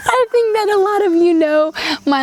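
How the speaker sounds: high burst of laughter running into quick words; laugh, then rush on while still amused. Real, not acted. Admitting to viewers her love-hate relationship with buttons, laughing.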